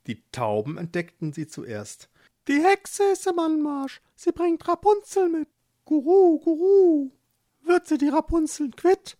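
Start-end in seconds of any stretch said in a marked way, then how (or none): 2.5-9.2 much higher, louder twittering dove voice; high, fluttery pigeon chatter; cooing doves. Acted. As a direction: coo the warning like an excited pigeon. The doves call out.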